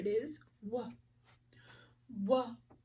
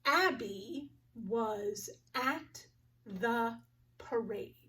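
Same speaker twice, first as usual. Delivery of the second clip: very slow, word by word, ending in a whisper; slow word-count reading; slower than usual. Shown as a demonstration. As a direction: count each word slowly. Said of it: "Let's count the words together".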